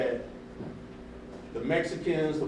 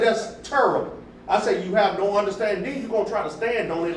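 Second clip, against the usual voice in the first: worked-up quote, pitched higher and louder; heated retelling; 5 semitones higher, 5 dB louder than usual. Retold or imitated. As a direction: replay the argument fired up, voice climbing. Retold heated argument continues.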